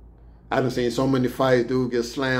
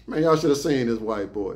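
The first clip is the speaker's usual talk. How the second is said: ordinary talk, nothing marked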